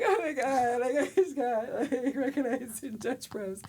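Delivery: giddy teary laugh; laughing through tears. Retold or imitated. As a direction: gush in disbelief. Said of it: "wiping my tears".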